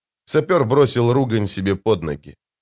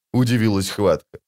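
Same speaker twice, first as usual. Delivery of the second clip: ordinary talk, nothing marked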